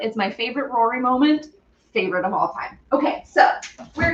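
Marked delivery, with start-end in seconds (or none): none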